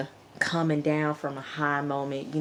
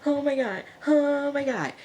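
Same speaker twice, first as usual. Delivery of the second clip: much higher, breathless, fast gushing worry; Emily fussing over Ezekiel, high frantic gush; 9 semitones higher, much faster than usual. Retold or imitated. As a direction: play the panicked girl rushing in, gushing. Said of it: "Emily walking up to Ezekiel".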